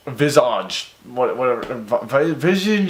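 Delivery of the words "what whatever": usual speaking voice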